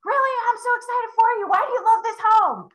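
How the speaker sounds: match their thrill and ask why; high, eager mirroring voice; excited. Shown as a demonstration. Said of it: "And I'm excited with them".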